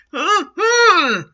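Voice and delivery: loud, high cry of pain after the blow; pained groan. Acted. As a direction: let out a hammed-up yelp of pain. Orderly hit below the belt.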